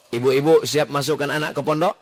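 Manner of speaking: ordinary talk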